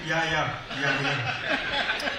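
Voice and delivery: hammed-up forced cheer, smiling through it; forced polite smile. Host smiling at an unwanted guest, falsely cheerful. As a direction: fake welcoming yeahs with a put-on laugh. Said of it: "But you smile and say".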